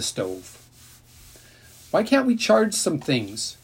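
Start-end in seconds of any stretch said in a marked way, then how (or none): 1.9-3.6 high coaxing wheedle; wheedling. A narrator's reading. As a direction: coax it out of Dad, higher. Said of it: "Ellie said in her wheedling voice".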